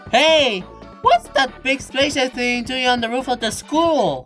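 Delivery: high-pitched astonished question; surprised onlooker. Acted. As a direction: call out in cartoon amazement. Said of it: "nobody looked up and said".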